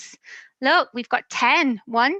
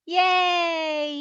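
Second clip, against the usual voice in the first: cheer; 5 semitones higher than usual. Real, not acted. Cheering at story's end.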